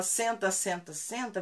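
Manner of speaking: "Senta, senta, senta" emendado como uma frase; comando repetido. Shown as a demonstration. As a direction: repita "senta" em série, sem pausa de espera. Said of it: "vira uma frase". ("senta" insistente, um atrás do outro)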